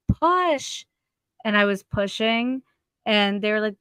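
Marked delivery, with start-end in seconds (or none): 0.1-0.9 high, clipped order; mock doctor command. Doctor ordering the mother to push, mimicked high. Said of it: "she was like".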